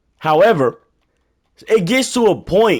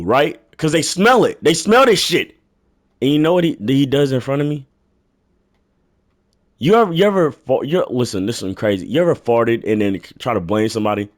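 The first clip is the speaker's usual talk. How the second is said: usual speaking voice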